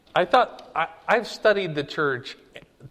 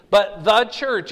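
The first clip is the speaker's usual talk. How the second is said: slow, louder THE punched before church; stress on THE; 4 semitones higher, 5 dB louder than usual. Real, not acted. Teacher correcting plural to singular, stress on THE. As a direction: hit THE hard, church falling.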